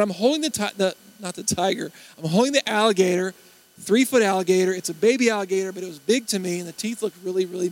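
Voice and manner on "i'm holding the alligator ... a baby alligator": ordinary talk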